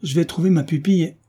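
an indignant baron exclaiming. Acted. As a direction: burst out with it. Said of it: "s'écria le baron".

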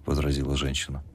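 usual speaking voice

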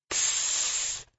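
soft, drawn-out hushing hiss; whispered shush. A narrator's reading. Girl suddenly grabbing her friend's arm, whispered shush. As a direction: hush them with a quiet "shh". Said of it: "шепнула она".

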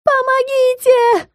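very high, drawn-out wail; hammed-up call for rescue; cry for help. A narrator's reading. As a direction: stretch it into a high yell. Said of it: "поддержала его Карина".

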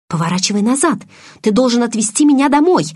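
требуй всё выше и громче; возмущённый приказ, с нажимом к концу; гневное требование. A narrator's reading. Требует повернуть домой. (гневно требуя)

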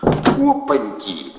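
drawn-out booming Opanki; brash gangster bursting in; loud brash thug. A narrator's reading. Thug greets his friends at the door, booming.

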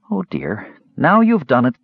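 worried barmaid, pitch leaping up. A narrator's reading. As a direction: sound worried while trying not to laugh. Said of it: "She sounded worried".

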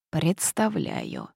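low, drawn-out sour mumble. A narrator's reading. Witch answers an excited first-year about the legend, sour mumble. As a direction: mutter it slowly and sourly, pitched well down. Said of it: "кисло пробормотала я".

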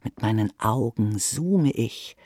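normal talking voice

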